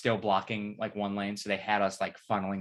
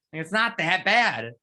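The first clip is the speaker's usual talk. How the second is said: mock reassurance; 9 semitones higher, 7 dB louder, slower than usual. Mocking friends after porta potty.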